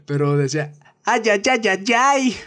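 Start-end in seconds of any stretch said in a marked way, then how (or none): 1.0-2.4 high yelping cries, voice cracking; Alpha's cracked voice. Retold or imitated. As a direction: squeal it until the voice breaks. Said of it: "Pero me salió un gallote".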